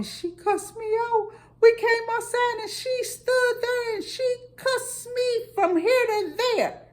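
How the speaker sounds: high, slow tearful voice; imitating a brother sobbing out the story; quoting a crying younger brother, high and slow. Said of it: "called me at work crying".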